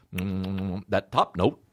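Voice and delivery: ice cream taster. Retold or imitated.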